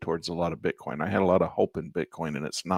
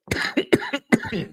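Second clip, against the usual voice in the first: harsh choking cough; coughing fit. Real, not acted. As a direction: cough hard, water down the wrong pipe. Choked on water, coughing.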